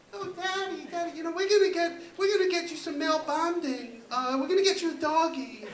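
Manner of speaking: high, cutesy little-daughter voice; little girls' voices. Retold or imitated. Little daughters promising Daddy a doggy, high girlish voice. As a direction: pitch it up like little girls pleading sweetly. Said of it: "these little girls and they're so cute".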